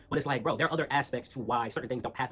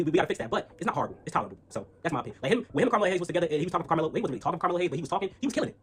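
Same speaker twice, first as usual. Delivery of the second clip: usual speaking voice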